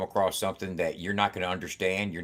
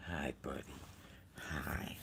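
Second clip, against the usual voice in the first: slow, hushed whisper to the cat; whispered awe at the cat; 4 semitones lower, 14 dB quieter than usual. Real, not acted. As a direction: breathe it out so the cat stays calm.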